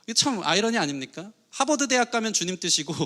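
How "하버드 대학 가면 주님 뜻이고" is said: ordinary delivery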